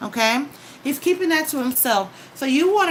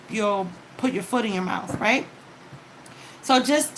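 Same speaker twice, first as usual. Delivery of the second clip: ordinary talk, nothing marked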